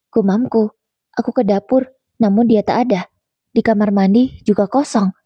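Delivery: ordinary delivery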